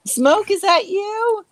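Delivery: calling out. Acted. Player calls into dark room.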